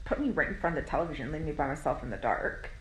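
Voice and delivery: ordinary delivery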